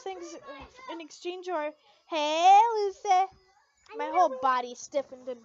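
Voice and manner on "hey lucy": loud call of her name. Acted. Natsu calls her.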